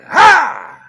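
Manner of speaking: sudden, very loud axe-swing shout; grandfather's roar. Retold or imitated. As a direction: let out a huge imitated chopping roar. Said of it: "his roar was so loud".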